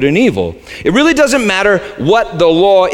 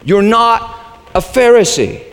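normal talking voice

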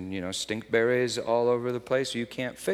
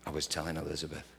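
ordinary delivery

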